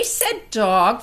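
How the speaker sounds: ordinary delivery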